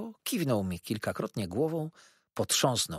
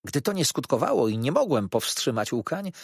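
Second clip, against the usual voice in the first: plain reading voice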